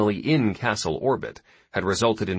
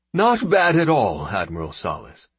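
approving commander; 5 dB louder than usual. Acted. New boss compliments her.

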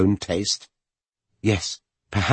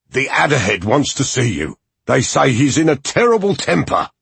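raised, nervous bodyguard voice; hurried warning, alarmed; alarmed henchman; 6 semitones higher, 4 dB louder than usual. A narrator's reading. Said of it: "looking alarmed".

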